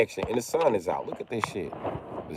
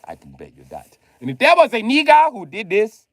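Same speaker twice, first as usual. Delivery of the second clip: ordinary talk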